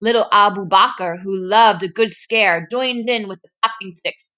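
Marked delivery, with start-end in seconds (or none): none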